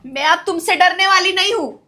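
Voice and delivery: loud, defiant, standing up to him; a widow loudly defying the brother-in-law who abused her; defiant outburst. Acted. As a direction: throw off your fear and hit him with it. Victim defies her abuser.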